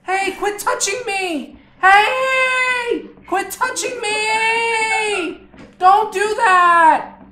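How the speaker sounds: put-on high, loud kid voice, yelling and crying to be let go; whiny kid. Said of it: "And he's like".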